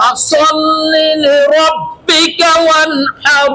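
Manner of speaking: slow chanted tajweed recitation; Quran recitation. Shown as a demonstration. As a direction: recite slowly with tajweed, holding the vowels. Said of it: "আরেকবার বলেন". (ধীর তাজবীদসহ তিলাওয়াত)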